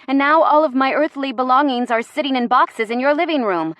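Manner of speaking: exasperated and insistent; indignant. Acted. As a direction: confront them, piling up grievances. Indignant confrontation over the move.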